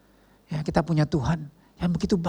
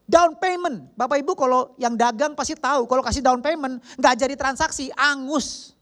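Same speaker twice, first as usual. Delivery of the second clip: high-pitched, driving sermon delivery; forceful preaching; 10 semitones higher than usual. Real, not acted. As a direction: explain it at a high, insistent pitch. Preacher explains the verse forcefully.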